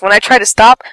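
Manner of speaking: ordinary delivery, nothing marked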